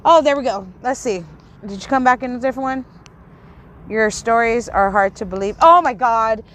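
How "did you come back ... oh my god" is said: usual speaking voice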